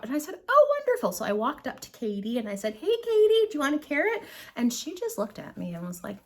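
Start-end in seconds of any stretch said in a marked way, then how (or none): 0.5-1.1 very high, louder gushing reply; mock delight. Acted delighted reply to owner. 2.8-4.2 high-pitched talking-to-animals voice; sweet coaxing. Retold or imitated. Acted coaxing call to an alpaca.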